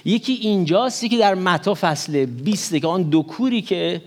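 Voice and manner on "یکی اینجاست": usual speaking voice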